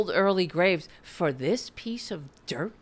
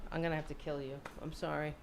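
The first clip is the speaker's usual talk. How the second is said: quiet mock apology; 5 semitones lower, 15 dB quieter, much faster than usual. Real, not acted. About to kill the fly.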